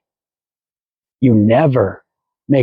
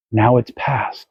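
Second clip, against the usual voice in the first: usual speaking voice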